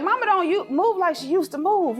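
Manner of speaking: high mock protest. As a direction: squeak it out like a tired mom teasing. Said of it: "But I'm like".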